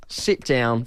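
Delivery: ordinary talk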